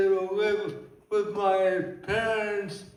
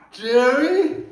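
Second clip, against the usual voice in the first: loud, higher questioning guess; confused stranger; 5 semitones higher, 8 dB louder than usual. Acted. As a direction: guess loudly, playing the puzzled listener. Stranger guesses his name wrongly.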